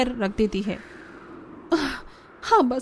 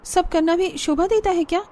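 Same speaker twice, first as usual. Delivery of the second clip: put-on nervous young wife; 7 semitones higher than usual.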